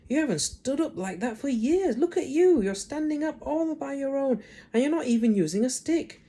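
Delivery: high, bright, wondering praise; amazed boy. Acted. Boy amazed as grandma stands unaided, excited. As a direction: marvel at her standing alone.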